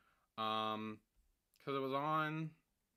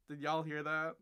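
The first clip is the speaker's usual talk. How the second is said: normal talking voice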